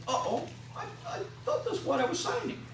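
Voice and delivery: mock dismay. Imitating confused patient.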